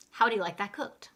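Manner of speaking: quick, linked "How would you like that cooked?"; fast natural English. Shown as a demonstration. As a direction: run "How would you" together at a brisk pace. Teacher repeats phrase in fast English.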